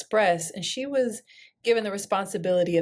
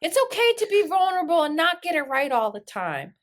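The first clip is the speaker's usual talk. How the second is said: higher, breezy, condescending "honey" voice; 7 semitones higher than usual. Retold or imitated. Glenda scolding the crowd, high and patronising. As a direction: play her as patronising and unbothered. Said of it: "flared up in a light-skinned rage and says".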